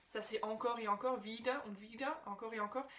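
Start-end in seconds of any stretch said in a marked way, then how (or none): none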